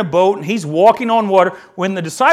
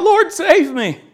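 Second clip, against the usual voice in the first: desperate cry; 9 semitones higher, slower than usual. A narrator's reading. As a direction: shout it out like a man sinking in the water. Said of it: "he cried out".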